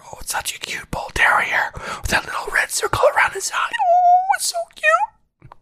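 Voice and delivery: very high, squeaky cooing; gushing baby talk. Acted. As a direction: coo over an adorable dog in falsetto. Gushing over a cute mascot dog.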